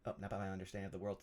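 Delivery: quick, quiet re-read to oneself; low, hurried re-reading; finding lost place. Real, not acted. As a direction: skim softly to find your place. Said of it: "I just lost my place there".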